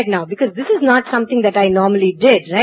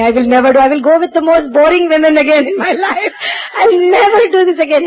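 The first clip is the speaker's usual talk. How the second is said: louder, higher half-laughing sobbing plea; crying prayer; 5 semitones higher, 4 dB louder than usual. Retold or imitated. Frightened girl praying to Swami for help, crying out. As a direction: beg through tears and laughter. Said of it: "I'm crying by this time".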